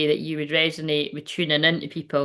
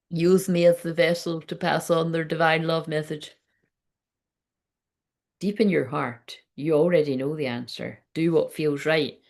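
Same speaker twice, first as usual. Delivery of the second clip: soothing spiritual voice. Acted. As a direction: deliver it like a gentle oracle. Channels a divine love message.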